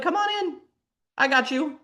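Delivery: sly ego. Acted. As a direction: lure them in sweetly, all falseness. Ego lures her in.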